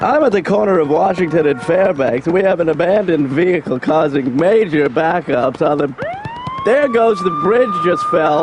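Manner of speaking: urgent on-the-scene reporter read; live traffic report. Pretend.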